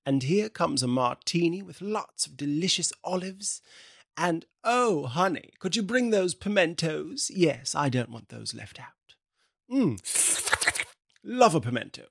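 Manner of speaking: put-on toothy socialite accent, bright smile; lightly put-on posh talk through the teeth. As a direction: speak through clenched teeth, grinning. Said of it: "I'm talking through my teeth".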